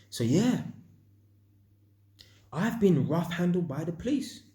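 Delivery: ordinary talk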